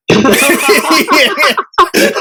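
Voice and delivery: high amused laugh; real delighted laughter. Real, not acted. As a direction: crack up at the punchline. Laughing at own punchline.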